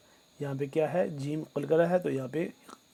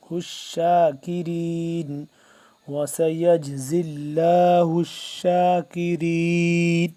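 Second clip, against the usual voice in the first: slow, drawn-out tajweed chanting; measured Quranic recitation; 8 dB louder, much slower than usual. Shown as a demonstration. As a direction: recite each word with long held vowels. Teacher recites Quran verse.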